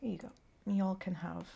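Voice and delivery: plain reading voice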